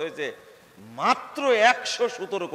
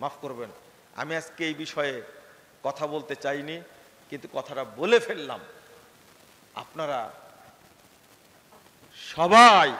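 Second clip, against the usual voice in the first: ordinary talk, nothing marked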